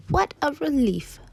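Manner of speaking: high, slow sigh of relief; relieved grandmother. Acted. Grandmother pops out safe, high drawn-out relief. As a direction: draw it out high, sliding down.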